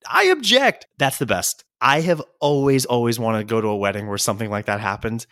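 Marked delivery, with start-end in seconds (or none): 0.1-0.9 mock objection. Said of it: "comes in and".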